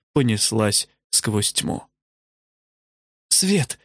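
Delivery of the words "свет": mild, high-pitched cry; a short narrated call-out, pitched up; cried out. A narrator's reading. Angie calls out to Molly in the dark car, mild cry. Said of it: "крикнула Энджи".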